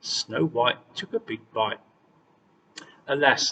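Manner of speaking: ordinary delivery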